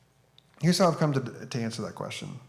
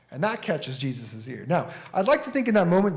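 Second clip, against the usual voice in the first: ordinary talk, nothing marked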